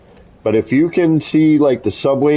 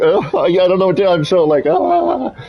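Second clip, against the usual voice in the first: breathless excitement; 7 semitones higher than usual. Real, not acted. Overwhelmed, laughing.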